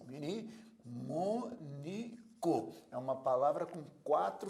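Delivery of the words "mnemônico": mnemônico slowly as mne, mô, ni, co; softer, sounded-out mnemônico; syllable division. Shown as a demonstration. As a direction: pause between syllables of mnemônico, mn kept together. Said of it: "divide as sílabas de mnemônico assim".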